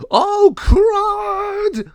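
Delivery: high, loud frightened shriek; a gargoyle yelping in terror; panicked cry. Acted. Gargoyle shrieks in panic seeing the raven, frightened cry. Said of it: "ran off afraid".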